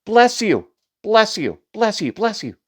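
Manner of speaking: lightly voiced string of different responders; chorus of well-wishers. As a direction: play a roomful of people chiming in. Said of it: "everybody says".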